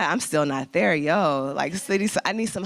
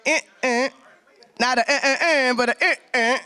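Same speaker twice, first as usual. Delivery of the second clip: mock air horn; 6 semitones higher than usual. Host imitating a halfway air horn for the crowd, hammed-up. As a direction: blare it like a half air horn. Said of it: "a halfway air horn".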